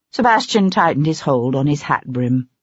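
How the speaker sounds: plain reading voice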